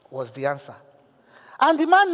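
ordinary talk

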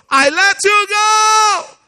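high, slow, loud cry of release; shouted release. Real, not acted. Preacher crying out to the congregation, high and loud. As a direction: cry it out with full force.

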